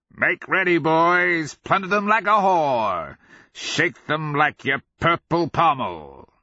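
high, loud madman's yell; rally the troops like a lunatic; crazed rallying cry. A narrator's reading. Crazed sergeant rallies his men.

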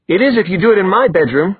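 high-pitched indignant retort. Acted. Brother snaps back in quarrel.

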